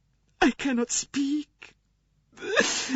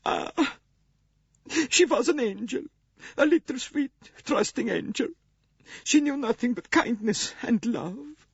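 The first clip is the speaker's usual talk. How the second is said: much higher, tearful, breaking voice; louder high weeping nurse; 3 dB louder than usual. A narrator's reading. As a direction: sob it out, grief-stricken. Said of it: "wept the wretched woman".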